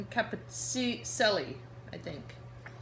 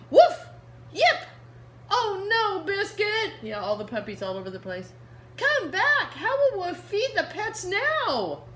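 much higher, louder alarmed call; 11 semitones higher, 8 dB louder than usual. Acted. Reading to kids, the girl calls after escaping puppies. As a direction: cry out as the puppies scatter.

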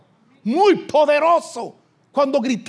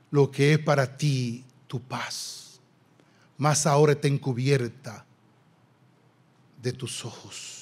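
solemn scripture reading; 8 semitones lower than usual. Acted. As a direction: read the verse tenderly and gravely. (lectura bíblica solemne, baja y pausada)

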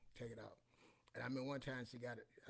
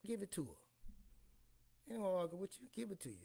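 fed-up giving in; 7 semitones higher, faster than usual. Retold or imitated. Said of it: "I said".

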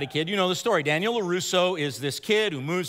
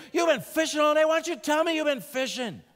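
Daniel blowing up; 9 semitones higher than usual. Retold or imitated. Daniel snaps at Miyagi after painting the fence all day, a mock angry outburst. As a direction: let the frustration burst out at the teacher. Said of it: "He blows up".

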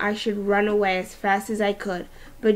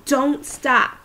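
a bit louder running call; yell; 4 semitones higher, 4 dB louder than usual. A narrator's reading. Henry yelling at Auggie while running, mild yell. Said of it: "would yell".

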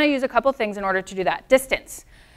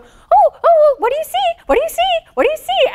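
much higher, louder anxious cooing; worried owner's high coo; 5 dB louder than usual. Retold or imitated. Client spotting another dog, fretting to her dog in a high anxious coo. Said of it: "her tone would do exactly that".

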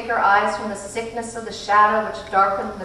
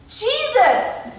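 high, loud eager cry; excited child; 6 dB louder than usual. A narrator's reading. Charles Wallace crying out the answer, high and excited. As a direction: burst out with the answer.

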